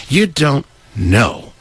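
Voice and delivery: normal talking voice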